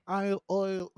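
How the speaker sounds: high, faltering try at Irish; I'LL sliding into Irish "oil"; failed Irish accent. Shown as a demonstration. Struggling with the co-host's lesson, attempted Irish accent. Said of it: "I can't do it".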